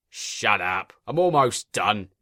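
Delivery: a mild, short-tempered snap; snapping. A narrator's reading. Engine cutting off the inspector, mild snap. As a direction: brush him off with a curt snap. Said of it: "snapped Spamcan".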